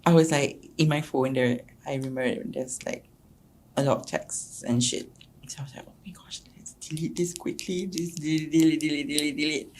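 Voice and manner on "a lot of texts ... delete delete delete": whisper. Retold or imitated. Secretly deleting phone texts.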